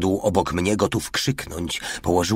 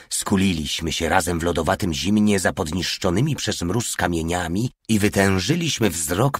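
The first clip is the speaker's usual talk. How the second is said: ordinary delivery, nothing marked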